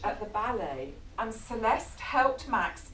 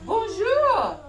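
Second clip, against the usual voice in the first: put-on high, loud girl's greeting; chirpy little ballerina; little girl greets Max, bright and high; 8 semitones higher, 8 dB louder, slower than usual. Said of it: "and she says".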